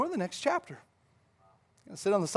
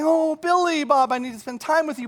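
gushing friend; 9 semitones higher, 6 dB louder than usual. Acted. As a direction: imitate an overeager churchgoer catching a friend. Acts out greeting a friend.

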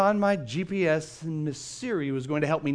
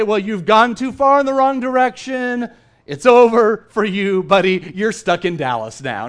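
mock harsh; 3 semitones higher, 9 dB louder than usual. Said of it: "Siri didn't say".

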